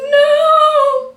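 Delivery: long, very high, loud cry; dramatic wail. Acted. Trainee hears protein bars are exempt, long high wail. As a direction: wail it out in despair. Acted inner wail at bad news.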